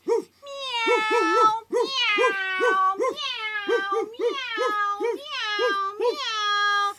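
high, long cat meows; meowing cat. Acted. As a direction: meow like a cat crowding the room. Cats were making cat sounds.

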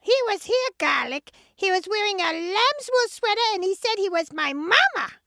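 high, agitated protest; upset sheep. Acted. Sheep tells husband about the disguised wolf, upset protest. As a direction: pour it out in a high voice.

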